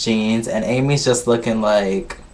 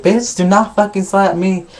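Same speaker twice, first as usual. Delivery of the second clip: escalating argument; 7 semitones higher than usual. Retold or imitated. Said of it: "the arguments escalate".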